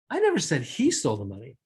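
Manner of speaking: he stressed with a rise. Shown as a demonstration. Teacher to class, stressing he. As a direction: hit he, lift at the end. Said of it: "based on how I accentuated that".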